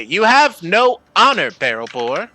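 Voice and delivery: high, loud accusation that drops on the name; accusing. Acted. Half-elf scolding the dwarf, accusing. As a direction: point the finger and scold him. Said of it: "and say".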